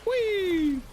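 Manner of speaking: high gleeful whee; playful whee. Pretend. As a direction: squeal it like a ride. Playful game noise.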